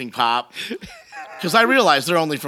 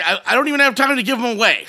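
ordinary talk, nothing marked

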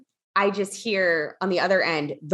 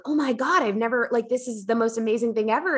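mildly awed, higher-pitched exclamation; blown away; 5 semitones higher than usual. Retold or imitated. Telling her friend about the amazing gifts, blown away. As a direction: gush in amazement, pitch lifted. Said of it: "I was blown away".

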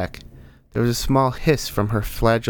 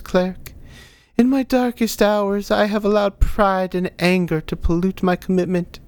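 put-on high-pitched old woman's voice; humble old woman; 10 semitones higher than usual. Ailing old missionary thanking the cleric, high and humble.

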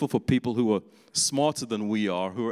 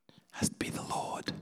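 hushed, reverent whisper; mock pious whisper; 8 dB quieter than usual. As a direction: breathe it like a holy secret. Mocking overly spiritual thinking.

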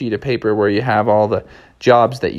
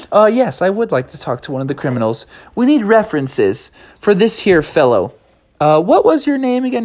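prim clerk voice; 8 semitones higher than usual. Acted.

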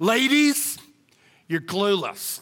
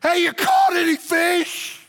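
shouted much higher and louder; yelling across the beach; 8 semitones higher, 4 dB louder than usual. Retold or imitated. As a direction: call out to fishermen far off. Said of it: "the dude on the beach yells".

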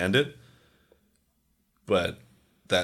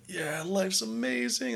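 mock elation; 3 dB quieter, slower than usual. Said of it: "you're like".

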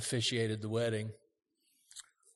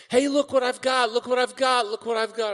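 high, loud bragging voice; mock bragging, showing off; 11 semitones higher, 7 dB louder than usual. Mocking a show-off.

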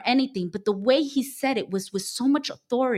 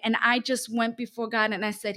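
ordinary delivery

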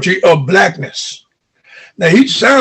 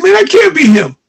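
high-pitched, incredulous retelling; astonished protest, retold in a higher pitch; retold disbelief; 9 semitones higher, 4 dB louder than usual. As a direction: re-enact being tripped out, pitch jumping up. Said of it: "really tripped me out".

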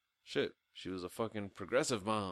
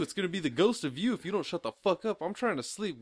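loud, raised-pitch irritated rant; mock angry scolding; 6 semitones higher, 5 dB louder than usual. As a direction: play a sleepless sibling threatening the kid. Acted sibling scolding the scared child.

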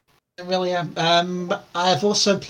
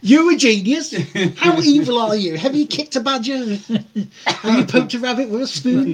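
high teasing questions; mock interrogation; 7 semitones higher, 4 dB louder than usual. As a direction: grill him playfully, pitched up. Mocking the kid with questions.

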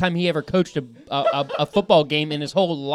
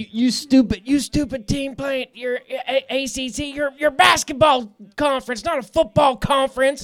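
mock drunk fan ranting; drunk yelling at the TV; 9 semitones higher than usual. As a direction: yell at the screen like a drunk fan. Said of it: "That was me last week, all drunk".

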